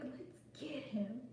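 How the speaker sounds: slow, scheming whisper; conspiratorial whisper. Retold or imitated. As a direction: whisper it like plotting a prank. Retelling hatching a prank.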